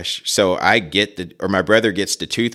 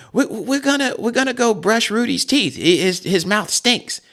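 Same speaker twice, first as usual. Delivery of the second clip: put-on much higher, innocent kid voice; little brother explaining. Brother Kelly explains to dad, high innocent kid voice. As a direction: play the small boy caught out. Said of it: "Kelly, goes".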